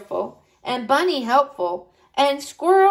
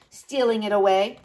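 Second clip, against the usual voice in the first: usual speaking voice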